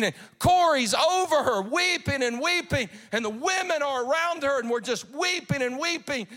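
loud, rising revival preaching; full-force preaching. Acted. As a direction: build the crowd up in a sermon.

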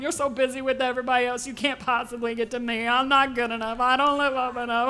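a timid believer praying without faith, voice turning tearful at the end; doubting prayer. Acted. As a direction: pray like you doubt God cares, close to tears. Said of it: "here's what we did not do".

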